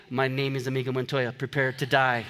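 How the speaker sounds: low, quick, deadpan movie quote; movie avenger. Acted. Pastor jokes about choice things for the risen Lord to say, low movie-avenger voice. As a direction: drop low and quote the vengeful film line softly. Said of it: "It is a famous quote from a classic".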